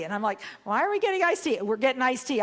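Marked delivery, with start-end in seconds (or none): 0.6-1.6 high, loud protesting WHY; exasperated question. Retold or imitated. Acted upset protest to companion. 1.6-2.4 raised, loud, firm statement; insistent answer. Retold or imitated. Acted companion's insistent answer.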